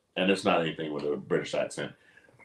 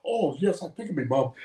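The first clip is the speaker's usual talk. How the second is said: higher-pitched mock British accent; lilting, put-on British voice; British actor; 8 semitones higher than usual. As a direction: imitate a British actor off camera. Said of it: "that is the British actor".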